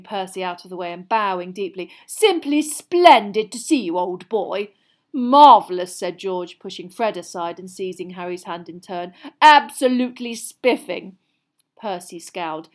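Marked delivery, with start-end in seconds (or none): 2.1-4.7 slow, loud, over-grand greeting; mock old-boy heartiness; mock pompous. A narrator's reading. Fred mocks Percy's pompous greeting. 5.1-5.9 loud, high mock delight; hammed-up grand enthusiasm; mock pompous. A narrator's reading. George joins the pompous mockery. 9.4-11.2 loud, high mock enthusiasm; hammed-up grand delight; mock pompous. A narrator's reading. George joins the pompous mockery.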